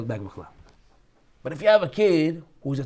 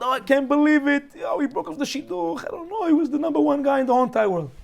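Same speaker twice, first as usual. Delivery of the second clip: higher pitched, overdone despair; mock distraught whine; 7 semitones higher than usual. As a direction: play a broken-hearted girl, tongue in cheek. Imitating upset girl over shidduch.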